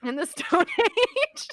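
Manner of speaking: giggling through the words; laughing. Real, not acted. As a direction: laugh while you say it. Laughing at friend's joke.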